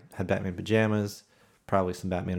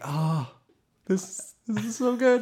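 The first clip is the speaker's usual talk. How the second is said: much higher, slow and laughing; giddy delight; 13 semitones higher, slower than usual. Retold or imitated. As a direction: savor it like a dream come true. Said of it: "And you're just like".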